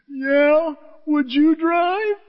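high, slow, drawn-out plea; imitated tearful brother. Brother begging preacher to take the wheel, mock pleading. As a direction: stretch it into a pleading whine. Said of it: "And he says to me".

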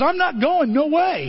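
high-pitched, bratty mock refusal; defiant attitude. Rude son telling his father no, with attitude. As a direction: put on a high voice full of attitude. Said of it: "he's got an attitude".

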